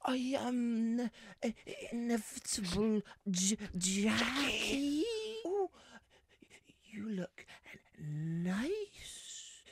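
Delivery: high, stuttering, hesitant oddball; halting high voice with stretched words; Jim. Acted. Oddball neighbour greeting Jackie and the boys, high stammer. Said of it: "Jim from Friday Night Dinner".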